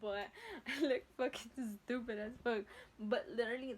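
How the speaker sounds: usual speaking voice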